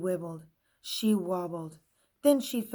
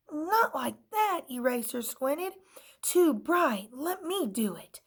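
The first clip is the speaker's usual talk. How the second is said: high, louder complaining; fussy eraser; 7 semitones higher, 5 dB louder than usual. A narrator's reading. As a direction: wince at the brightness. Eraser squints.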